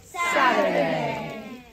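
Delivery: low, slow shout of Saturday; shouted refrain. Real, not acted. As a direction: belt the word out low and long.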